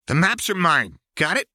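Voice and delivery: high, loud snarled warning. Acted. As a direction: snarl it as a threat. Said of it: "Jinx snarled".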